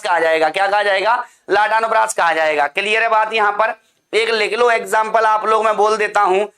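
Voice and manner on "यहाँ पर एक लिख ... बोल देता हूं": ordinary talk, nothing marked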